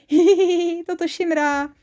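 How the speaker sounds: high, squeaky tickled giggle; ticklish giggling. Acted. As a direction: giggle helplessly as if being tickled. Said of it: "čert s andělem smáli".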